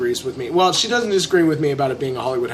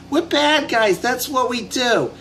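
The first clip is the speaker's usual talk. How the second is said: high, put-on Harley voice; a light Harley Quinn impression; 7 semitones higher, slower than usual. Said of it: "And Harley Quinn says".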